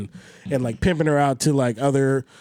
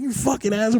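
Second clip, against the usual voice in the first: mock angry; 7 semitones higher, faster than usual. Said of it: "He's like".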